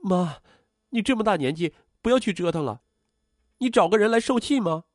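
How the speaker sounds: high-pitched, anxious daughter protesting; flustered. A narrator's reading. Daughter fears losing mother and objects, flustered. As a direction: plead with mother in rising alarm. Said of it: "这一下她慌了".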